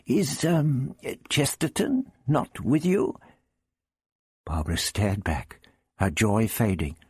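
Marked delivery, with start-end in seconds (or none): 0.0-3.2 inquiring. A narrator's reading. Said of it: "looked about him inquiringly".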